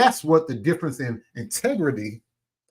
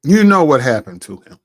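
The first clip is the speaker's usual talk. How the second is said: much louder, cutting accusation; 3 semitones lower, 10 dB louder than usual. Real, not acted. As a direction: throw it at him loudly. Accusing rival.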